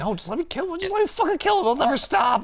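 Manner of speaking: 1 desperate Lizard. Retold or imitated. Lizard voiced wanting to kill.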